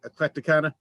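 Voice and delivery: usual speaking voice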